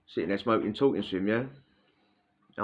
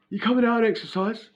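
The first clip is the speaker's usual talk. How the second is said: much higher, louder mock pal voice; imitating the pal; 12 semitones higher, 3 dB louder than usual. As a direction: bark it as the rowdy cellmate pal.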